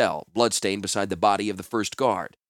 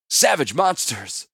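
slow, loud, higher seething outburst; angry; 6 semitones higher, 5 dB louder, slower than usual. Acted. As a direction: drag it out with fury. Klars raging at captors.

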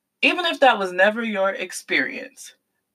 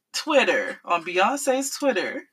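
usual speaking voice

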